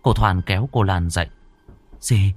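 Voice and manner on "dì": high, urgent wake-up call; alarmed older sister. Acted. Sister wakes sister to see mother, urgent alarm. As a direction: rouse her in halting, higher-pitched alarm.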